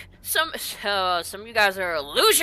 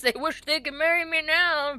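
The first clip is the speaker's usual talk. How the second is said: ordinary talk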